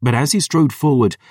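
ordinary delivery

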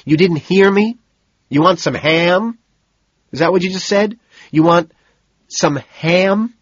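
high, loud mocking questions; taunting, high mock-questions; spiteful. A narrator's reading. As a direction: sneer the questions back at her. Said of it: "I ask spitefully".

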